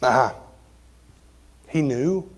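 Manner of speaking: ordinary talk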